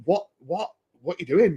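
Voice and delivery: high, stammering disbelief; stunned stammer. Retold or imitated. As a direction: stumble over the what in shock. Retold reaction to undressed customer.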